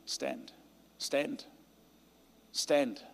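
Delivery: ordinary talk, nothing marked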